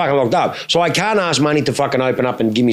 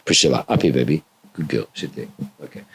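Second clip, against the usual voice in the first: low, much softer praise for a good dog; soothing the dog; 8 semitones lower, 4 dB quieter than usual. Real, not acted. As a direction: settle her down gently. Settling the dog.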